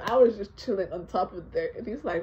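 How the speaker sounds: ordinary delivery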